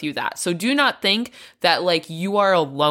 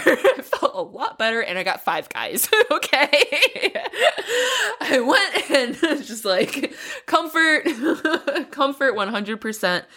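laughing; 8 semitones higher than usual. Real, not acted. Laughing about comfort shopping.

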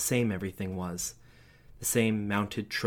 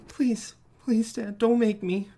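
high, frightened pleading; begging child; 13 semitones higher, 5 dB louder, slower than usual. A narrator's reading. Child begging dad not to make him go. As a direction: beg not to be sent down.

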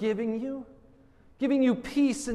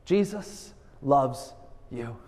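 slow and deliberate; 8 semitones lower, slower than usual. Shown as a demonstration. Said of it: "Can you do it with this simple message?".

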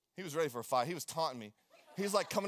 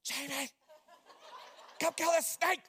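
whisper yelling; 9 semitones higher than usual. Retold or imitated. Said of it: "I was, like, whisper yelling".

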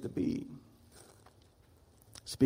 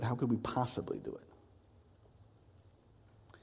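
hushed rhetorical question; 10 dB quieter, much slower than usual. Acted.

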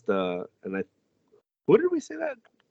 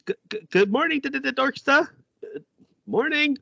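high, stuttering, slightly louder nervous greeting; flustered stammer; 4 dB louder than usual. Retold or imitated. Noelle greeting Asta she has feelings for, high flustered stammer. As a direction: stumble over every word like a shy girl panicking.

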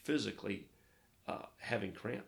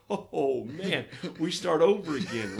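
loud, higher, laughing; mock weary sigh; 6 semitones higher, 10 dB louder than usual. Arriving at each new island, loud laughing mock weariness. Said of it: "it's like".

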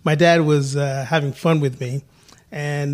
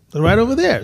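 higher, louder, acted-out kid's reply; eager kid; 7 semitones higher, 5 dB louder, faster than usual. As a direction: play the keen child pointing out parents. Child pointing out his parents.

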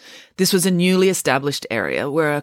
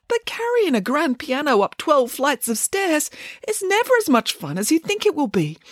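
higher-pitched innocent bear voice; naive, earnest bear; 8 semitones higher than usual. Acted. Boris explains why he declines moving jobs, naive earnest bear. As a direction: play a simple-hearted bear, pitched up.

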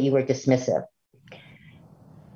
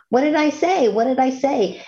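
much higher, insistent repeated challenge; aggressive tone; 9 semitones higher than usual. Acted. Lecturer imitating someone who repeats a sentence, aggressive tone. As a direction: demand it accusingly, pitched way up. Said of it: "don't really sound that damaging or that aggressive".

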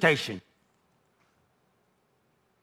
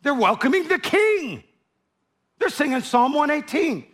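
higher, mock-alarmed Pharisees; 7 semitones higher than usual. Pharisees alarmed to each other, higher voice. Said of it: "They, they're like".

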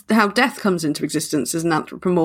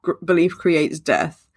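slow and emphatic; slower than usual. Real, not acted.

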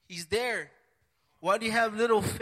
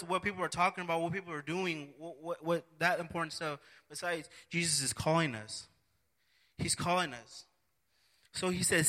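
usual speaking voice

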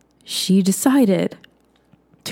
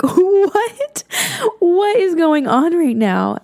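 much higher, laughing, slowed what is going on; stunned disbelief; 10 semitones higher, much slower than usual. Retold or imitated. Recalling the shock of meeting her angel again, high and laughing. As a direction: squeal it out in giddy amazement, half laughing.

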